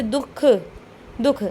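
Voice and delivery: दुख with a breath of "ha" inside; teacher's careful दुख, showing the h sound; h-like visarga. Shown as a demonstration. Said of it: "हा की ध्वनि आ रही है".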